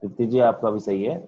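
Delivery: usual speaking voice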